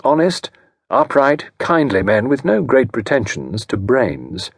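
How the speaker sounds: ordinary delivery, nothing marked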